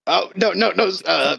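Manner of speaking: flustered backing down. Acted. Threatened character backing away from Thorgrin's dagger, mildly panicked.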